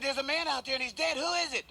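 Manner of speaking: high, breathless alarmed voice; panicked lady. Acted. Lady returning home finds the man dead, high and alarmed. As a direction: gasp it out in alarm.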